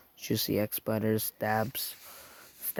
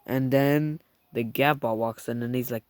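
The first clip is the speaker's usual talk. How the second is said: usual speaking voice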